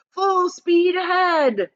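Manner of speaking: high, loud commanding shout; Wonka's shout. A narrator's reading. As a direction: shout it to the rowers. Wonka urging the rowers.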